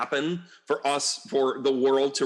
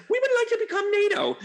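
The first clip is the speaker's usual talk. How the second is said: much higher, sweet mock request; mock Ukraine; 3 dB louder than usual. Mocking Ukraine asking to join NATO, high sweet voice. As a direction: pipe it up high and polite. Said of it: "Ukraine was like".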